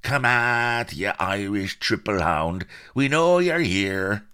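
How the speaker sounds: put-on high, loud rough-accented pirate shouts; buccaneer's raucous, drawn-out cry; pirates calling out. A narrator's reading. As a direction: holler into the bushes for a hidden quarry. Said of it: "and cried".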